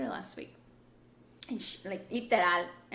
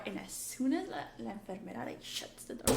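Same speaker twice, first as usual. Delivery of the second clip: ordinary delivery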